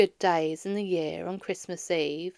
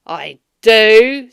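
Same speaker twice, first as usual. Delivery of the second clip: Scrooge curt; 16 dB louder than usual. A narrator's reading. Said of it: "said Scrooge".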